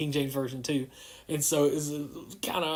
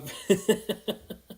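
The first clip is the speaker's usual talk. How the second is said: short, higher chuckle; laugh; 5 semitones higher than usual. Real, not acted. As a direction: chuckle briefly to yourself. Laughs amid awkward greetings.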